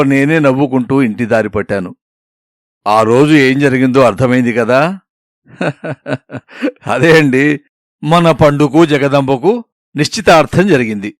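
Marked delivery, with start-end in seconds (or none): 5.6-6.4 knowing little storyteller's laugh; chuckle. Acted. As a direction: laugh quietly to yourself. Narrator chuckles before revealing the ending.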